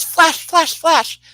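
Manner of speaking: high, playful imitation of her call; half kidding. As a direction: call the flashes out high, as if joking. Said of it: "half kidding".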